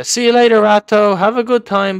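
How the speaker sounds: high, loud mocking farewell; gloating shout. Real, not acted. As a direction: call it after a beaten rival. Taunts player sent flying.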